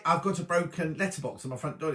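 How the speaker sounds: usual speaking voice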